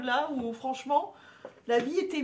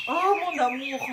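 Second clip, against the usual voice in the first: swooning; 3 semitones higher, 6 dB louder than usual. Real, not acted. Swooning over wrestler poster.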